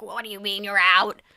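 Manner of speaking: loud put-on voice of a crime boss; Nick Nolte. Boss answering the thief who quits, loud put-on voice. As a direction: challenge him in a loud character voice. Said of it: "And Nick Nolte's like".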